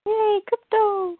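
high, slow singsong cheer; playful cheer. Pretend. Co-host cheering for Krypto, playful cheer. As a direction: join in with a stretched little hooray.

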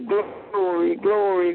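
ordinary delivery

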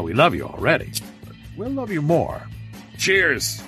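ordinary talk

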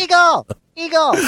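high, loud yell; shouting. Real, not acted. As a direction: shout it across the room. Shouting a code word.

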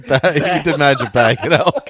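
laughing through the correction; giggling over bag an elk. Real, not acted. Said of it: "bagged, sorry".

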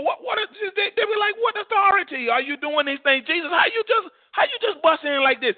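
high, flustered, stammering indignation; mock outrage. Preacher voicing priests confronting intruding Jesus, comically outraged. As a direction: pitch it way up, scandalized and sputtering.

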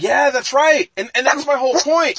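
animated debate voice; excited insistence. Real, not acted. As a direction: jump in fired up to win the argument. Heated argument over Marvel films.

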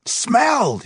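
high, loud roar. Acted. Foreman roaring in disbelief at the worker. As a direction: roar the word back at him. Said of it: "he roared".

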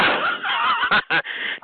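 high triumphant cackle; gleeful cackle. Real, not acted. Streamer mocking a struggling player, high cackle. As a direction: crow with laughter at the rival. Gloating after telling rival work.

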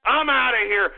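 ordinary talk

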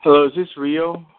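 normal talking voice

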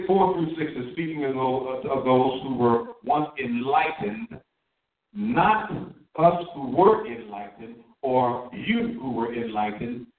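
ordinary delivery

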